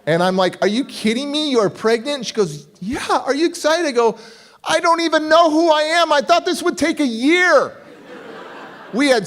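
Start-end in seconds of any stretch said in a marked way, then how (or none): 0.6-2.2 high disbelieving cry; stunned, voice jumping up; retold shock, pitched high. Shocked at the news. 2.8-3.9 wife's voice. Retold or imitated. Wife voiced asking. 4.6-7.8 high, louder panic; mock panic, pitched high and loud; panicked. Panicked reply.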